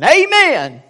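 high, loud "amen" shout; excited church outburst. Retold or imitated. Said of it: "he got all excited".

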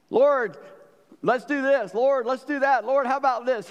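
high, loud, eager suggestions; pushy Peter. Retold or imitated. Peter tells Jesus what to do, high and eager. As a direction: keep pushing your ideas on the Lord.

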